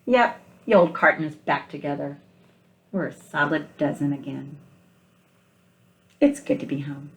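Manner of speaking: picture-book read-aloud, gentle and unhurried; story narration. Acted. Teacher reading picture book aloud.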